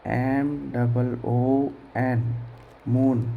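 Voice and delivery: letter by letter, very slow; slow classroom spelling; spelled out slowly. Shown as a demonstration. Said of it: "मून यानी होता है चंद्रमा".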